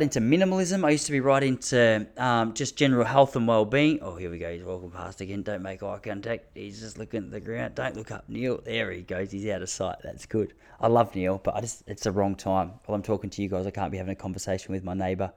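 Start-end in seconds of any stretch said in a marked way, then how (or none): 4.0-15.3 much softer, low hushed commentary; real hushed voice while the neighbour passes. Real, not acted. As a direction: keep it down so the neighbour won't hear. Hiding from neighbour walking past.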